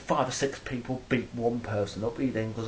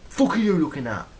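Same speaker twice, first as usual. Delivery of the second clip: loud, higher aggressive yell of a street thug; 6 semitones higher, 10 dB louder than usual. Retold or imitated. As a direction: snap it at him like a thug looking for trouble. Acted street confrontation, aggressor.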